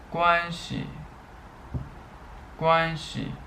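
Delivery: high, careful first-tone readings, each word twice; high level first tones held steady on every syllable; Mandarin tone drill. Shown as a demonstration. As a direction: read each word out clearly like a teacher modelling tones. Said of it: "I'll read more".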